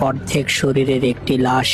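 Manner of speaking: ordinary delivery, nothing marked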